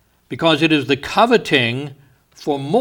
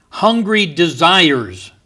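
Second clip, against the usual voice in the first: ordinary talk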